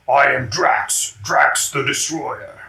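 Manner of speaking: slow, heavy, proud declaration; Drax intro. Acted. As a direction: draw it out like a proud brute. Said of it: "He's like".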